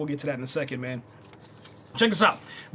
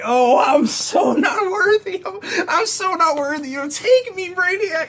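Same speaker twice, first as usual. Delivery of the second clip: high, loud, hammed-up pleading; mock worship; 13 semitones higher, 6 dB louder than usual. Fan pretending to kneel before the villain, high loud mock pleading. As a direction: wail it high like a swooning devotee. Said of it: "got on my knees and be like".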